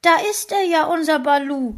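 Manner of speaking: wife spots the lost dog and cries out; joyful cry. A narrator's reading. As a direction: shout out in delight at the window. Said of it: "stieß einen Jubellaut aus".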